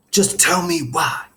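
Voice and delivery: exasperated coach demanding an answer; stern demand. A narrator's reading. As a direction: press him hard for a reason. Coach confronts boy, door just slammed.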